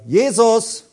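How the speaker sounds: sharp loud cry of Jesus; calling out Jesus. Retold or imitated. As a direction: call the name out loud like a rebuke. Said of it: "wirst du sagen".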